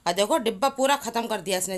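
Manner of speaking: loud, high, playful telling-off; teasing scold. Real, not acted. Mother loudly shaming her chocolate-smeared son to viewers. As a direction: show everyone the mess he made, half laughing at him.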